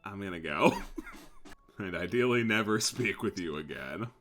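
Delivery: usual speaking voice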